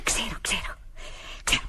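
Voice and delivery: low, excited whisper to herself; whispered realisation. Acted. Girl alone finds where to hide, whispering. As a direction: whisper it repeatedly as the idea lands.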